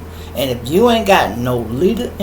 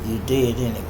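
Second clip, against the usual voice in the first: ordinary talk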